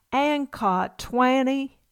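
drawn-out puzzled Southern question; Southern accent. Retold or imitated. Ma mishears Pa again, slow, in a mock Southern accent. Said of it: "in their strongest Southern accent".

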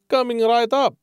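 high, bright barman; chirpy eager bartender. Acted. Bartender answers.

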